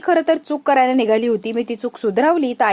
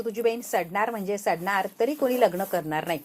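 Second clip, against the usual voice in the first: quiet, lower vow of revenge; low menacing curse; 3 semitones lower, 7 dB quieter than usual. Acted. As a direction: threaten her coldly under the breath. Vikram's parting curse. (हळू आवाजात धमकी)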